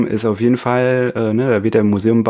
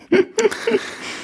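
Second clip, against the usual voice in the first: high giggle; 3 dB quieter than usual. Real, not acted. Laughs at own joke.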